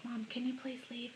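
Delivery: soft, polite pretend plea; hushed, sweet little request; pretend child asking Mom. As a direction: quietly model asking Mom a favour. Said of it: "Ask your mom".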